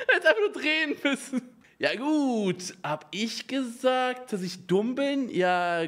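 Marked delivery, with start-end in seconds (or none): 0.1-1.5 laughing through the words; giggling while speaking. Real, not acted. Repeats the line while laughing.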